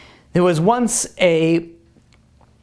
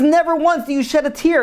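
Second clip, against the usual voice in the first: high-pitched retold rebuke; acted-out indignation, raised pitch; indignant soldier; 10 semitones higher than usual. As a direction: scold him with the pitch held high. Soldier confronting the crying smuggler.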